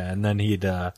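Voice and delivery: usual speaking voice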